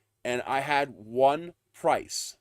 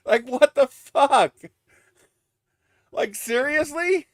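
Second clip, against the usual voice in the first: high, slow, incredulous outburst; disbelief; 11 semitones higher, 7 dB louder, slower than usual. Real, not acted. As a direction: stretch it out in disbelief.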